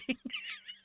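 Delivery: short squeaky laugh; high laugh. Real, not acted. Laughing at the creepy project, high squeak. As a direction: let a high laugh slip.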